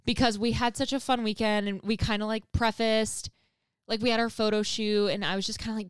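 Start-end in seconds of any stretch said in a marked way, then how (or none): none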